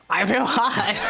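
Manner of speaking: laughing. Pretend. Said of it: "specify your voice".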